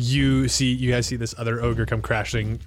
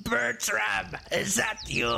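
higher, softer voice from afar; distant call; 9 semitones higher, 6 dB quieter than usual. Acted. Unseen stranger calling for Bertram from afar. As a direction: call from down the trail. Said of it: "you hear, coming towards you all".

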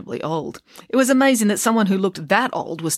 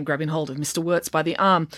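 plain reading voice

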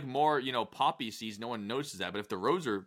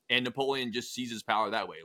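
ordinary delivery

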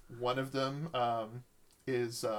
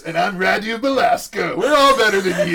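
higher, loud villain boast, laughing at the end; boastful shout; 7 semitones higher, 16 dB louder than usual. Acted. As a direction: shout the taunt like a gloating vampire. Vampire boasts to the party.

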